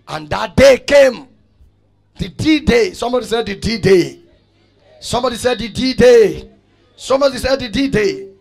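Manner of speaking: loud, punchy climax to the congregation; rousing preacher's call. Real, not acted. Preacher rallying the congregation for the big day, rousing shout. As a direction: proclaim it loud and make them repeat it.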